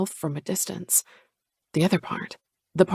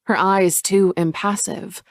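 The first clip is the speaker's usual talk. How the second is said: ordinary delivery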